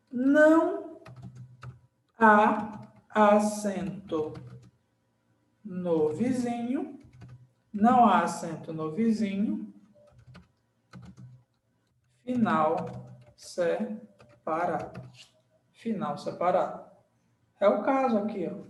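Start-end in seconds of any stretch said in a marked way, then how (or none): 0.1-7.0 slow dictation. Shown as a demonstration. Teacher writing the rule's second half, slow dictation. 12.2-15.0 broken up slowly, SE, then PARADO; slow dictation. Shown as a demonstration. Teacher writing the rule's ending for students, slow dictation.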